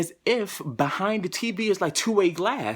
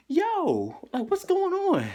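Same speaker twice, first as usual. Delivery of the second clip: much higher, baffled call; retold surprise; 13 semitones higher, slower than usual. Patient to nurses in hallway, high baffled call. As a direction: act thrown by what you see. Opens door to hospital hallway.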